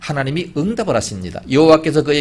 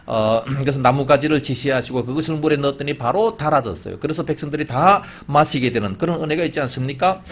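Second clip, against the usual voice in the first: ordinary talk, nothing marked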